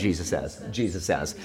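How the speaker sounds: softly said to a neighbor; quiet aside. Shown as a demonstration. Pastor prompting the congregation, said softly to a neighbor. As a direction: murmur it to the person next to you. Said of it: "Turn to a neighbor and say".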